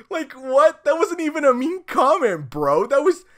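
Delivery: loud, high disbelief; can't believe it was called rude; incredulous outburst. Real, not acted. Disbelief at comment.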